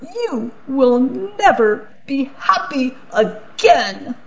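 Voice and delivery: put-on high, furious cursing of the girl; angry curse. Archduke curses the little girl, high and furious. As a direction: spit the curse out high and hard.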